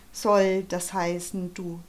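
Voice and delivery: plain reading voice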